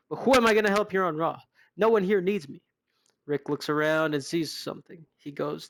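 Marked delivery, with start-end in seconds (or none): 0.1-2.6 higher, louder and rushed, hurt; whiny plea. Acted. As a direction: blurt out a needy complaint. Rick abandoned, pleads after Mercedes.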